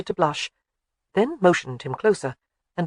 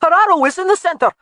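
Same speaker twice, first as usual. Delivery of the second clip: excited shout, much higher and louder; 13 semitones higher, 8 dB louder than usual. A narrator's reading. Young soldier shouts from the target, excited shout. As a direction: yell it back up the hill. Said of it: "he shouted".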